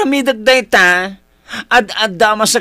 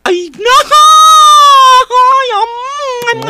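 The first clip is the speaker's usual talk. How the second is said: startled cry; 8 dB louder, much slower than usual. Acted. Startled outcry in scene.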